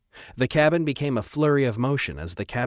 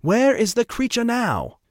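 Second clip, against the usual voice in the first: high, loud, drawn-out call; piercing demand; 12 semitones higher, 6 dB louder than usual. A narrator's reading. Trevor demands the creature's position, in a piercing voice. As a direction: pierce the cabin with an imperious question. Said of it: "Trevor's voice pierced the cabin again".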